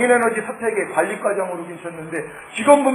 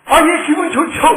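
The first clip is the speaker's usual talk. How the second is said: overjoyed reply; 7 semitones higher, 5 dB louder than usual. Retold or imitated. Answering God, overjoyed.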